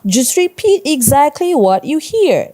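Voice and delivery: high, loud teacher's instruction; instructing teacher. A narrator's reading. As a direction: press the instruction firmly on the class. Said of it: "Mister Sanchez instructed".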